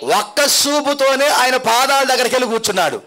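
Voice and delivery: building preacher's cry; rising sermon build. Acted. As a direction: climb with every repeat, preaching. Preacher building repeated lines.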